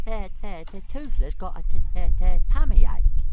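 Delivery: high, stuttering little dragon whine; stammering complaint. Acted. Toothless complaining to Hiccup of a tummy ache, high stammering whine. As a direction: moan it like a sulky small dragon. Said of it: "he complained".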